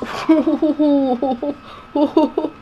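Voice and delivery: high, loud burst of laughter; real laughing fit. Real, not acted. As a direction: crack up in long hooting laughs. Laughs at the game scene.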